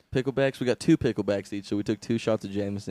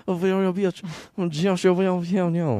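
put-on loud, high gibberish French accent; hammed-up French accent dissolving into mush; mock French accent; 7 semitones higher, 5 dB louder than usual. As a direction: garble it into cartoon French. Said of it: "Why do French people sound like".

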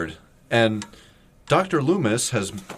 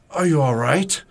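put-on voice, raised in pitch; theatrical mock concern; Doctor Loomis; 4 semitones higher, 3 dB louder than usual. Host mimics Loomis startling a terrified woman, mock concern. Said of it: "He says".

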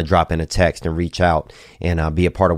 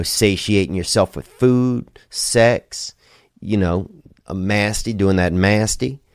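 usual speaking voice

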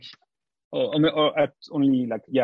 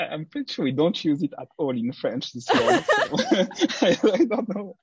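laughing; 8 semitones higher than usual. Real, not acted. Amused at odd French lesson.

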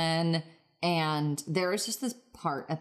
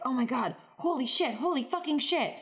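mock excited cursing; 7 semitones higher than usual. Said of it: "he's like".

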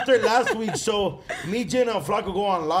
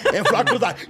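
usual speaking voice

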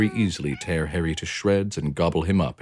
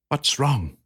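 gentle concern; 8 semitones higher than usual. Acted. Hamster asks weeping cat.

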